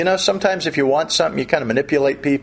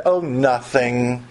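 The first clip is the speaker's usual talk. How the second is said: slow, drawn-out sulky brush-off; mock sulking; 5 semitones lower, much slower than usual. Sulker answering the king's question, slow mock sulk. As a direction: drag it out as if something is wrong. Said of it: "So he wasn't sighing".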